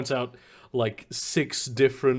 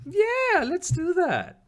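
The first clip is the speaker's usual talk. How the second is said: eager; much slower than usual. Acted. NPC eagerly agrees.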